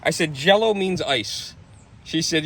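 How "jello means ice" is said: slow, spelled-out "Jello means ice"; mild dawning disbelief; slow realization. Retold or imitated. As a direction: drag it out as the penny drops.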